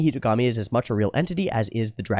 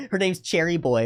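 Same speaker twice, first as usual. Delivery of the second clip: imitating a friend's juvenile snickering, higher; mock giggling; 6 semitones higher than usual. Friend giggling at the crude jokes, mock giggling. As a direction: snicker through it like an immature viewer. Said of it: "they're going".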